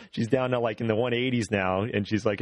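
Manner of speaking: ordinary talk, nothing marked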